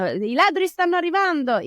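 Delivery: put-on high child voice in native Italian. Child speaking Italian to a parent, lively and high. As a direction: say I ladri stanno arrivando as a native Italian child. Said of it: "if they're speaking Italian".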